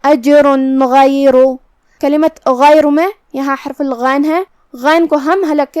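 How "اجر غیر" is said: slow اجر غیر, clear noon with no nasal hum; izhar demo. Shown as a demonstration. Teacher demonstrating izhar slowly on اجر غیر. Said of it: "نون ساکن پڑھیں گے اور بغیر غنہ سے".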